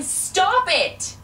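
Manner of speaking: high loud cry to stop them; desperate shout at the boys; yelling. Retold or imitated. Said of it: "And I started yelling".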